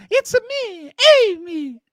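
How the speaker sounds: high singsong mock Italian accent; teasing fake Italian accent. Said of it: "you gave me the accent".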